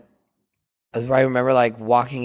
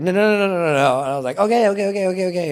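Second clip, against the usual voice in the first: flustered; 7 semitones higher, much faster than usual. Retold or imitated. Retold hurried refusal and agreement.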